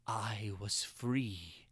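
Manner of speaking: a slow low breath of relief; hushed whisper. A narrator's reading. Freed prisoner whispering so the watchers won't hear. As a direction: whisper it, slow and low, barely daring to believe.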